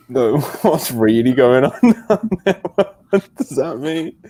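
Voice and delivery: giggling through mocking echo; laughing teasing. Real, not acted. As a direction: laugh while teasing him back. Teasing echo of friend's words.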